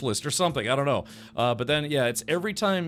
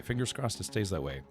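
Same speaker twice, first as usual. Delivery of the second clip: quiet; 5 semitones lower, 6 dB quieter than usual. Real, not acted. Repeat, dropped to a murmur.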